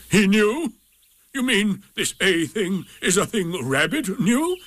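high, incredulous donkey voice; indignant disbelief. A narrator's reading. Eeyore learning that Rabbit knew the A, indignant. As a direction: question it with affronted disbelief.

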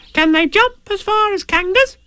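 tiny, very high squeaky youngster; high-pitched eager little voice; Roo's voice. Acted. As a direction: squeak the question like a curious little one. Roo questions Tigger.

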